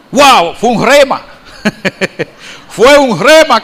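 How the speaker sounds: laughing through "Fue un rema". Real, not acted. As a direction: let the laugh spill into the words. Laughs recalling the verse.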